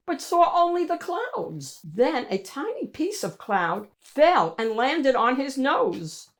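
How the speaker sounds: ordinary delivery, nothing marked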